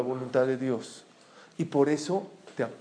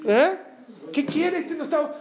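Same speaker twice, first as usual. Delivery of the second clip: much higher, bewildered mock questions; the brothers puzzling over Joseph's demands, squeaky high; puzzled brothers; 11 semitones higher than usual. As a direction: pitch it up like confused brothers.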